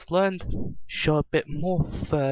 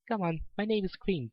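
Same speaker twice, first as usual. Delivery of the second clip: put-on higher, softer child voice; friendly small girl introducing herself; little girl voice; 7 semitones higher, 5 dB quieter than usual. A narrator's reading. Cream telling the pony her name, soft child voice.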